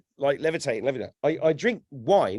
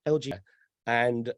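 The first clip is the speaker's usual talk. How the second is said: normal talking voice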